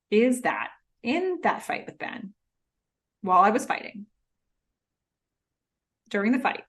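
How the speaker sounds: halting mock storytelling with long pauses; painfully slow. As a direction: drag out the explanation, pausing between phrases. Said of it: "he goes so slowly".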